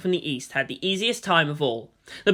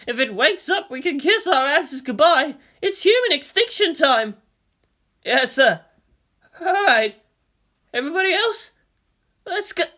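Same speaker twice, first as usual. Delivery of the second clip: higher-pitched tense commander voice; squad leader orders; 9 semitones higher than usual. Acted.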